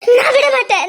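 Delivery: loud, tearful refusal; loud crying cling. Acted. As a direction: cry out and hold on. Wife clinging to husband. (உரக்க அழுதபடி மறுப்பு)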